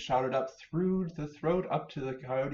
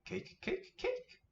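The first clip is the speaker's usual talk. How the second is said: tiny squeaky bunny, much higher; little bunny voice; 5 dB quieter than usual. Acted. Minnie Rabbit to Mother Rabbit, squeaky high bunny voice. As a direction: chirp it like an eager baby rabbit. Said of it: "Minnie Rabbit says".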